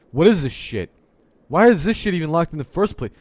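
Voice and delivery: high, loud furious yelling; angry shout. Real, not acted. Coder yelling at a locked file, furious shout. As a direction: scream it at the screen.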